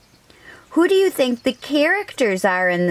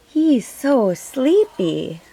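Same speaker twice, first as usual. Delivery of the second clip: plain reading voice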